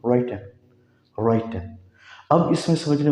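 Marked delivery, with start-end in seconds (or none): 0.1-1.7 राइटिंग said as raiten, no ng; correct -in ending. Shown as a demonstration. Teacher models राइटिंग for students, ending in en. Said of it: "राइटिंग नहीं है".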